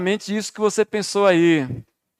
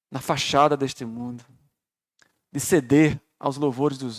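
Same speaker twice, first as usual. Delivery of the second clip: prayer; 4 semitones lower, 5 dB quieter than usual. Acted. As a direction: pray it quietly and sincerely. Closing prayer.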